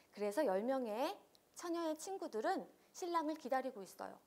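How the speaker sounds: normal talking voice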